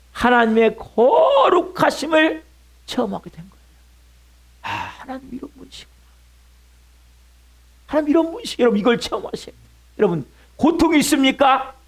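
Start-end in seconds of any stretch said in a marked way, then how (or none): 4.6-8.6 very soft, near-whispered awe; hushed realization. Acted. Preacher voices a sufferer discovering God's holiness, hushed realization. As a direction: sigh it out quietly, higher and far softer.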